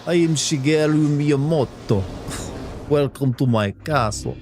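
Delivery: slow, hammed-up Transylvanian welcome; mock Transylvanian host at the castle gate; Transylvanian accent. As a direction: greet them like a vampire count, in a Transylvanian accent. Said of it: "like, Transylvanian accent".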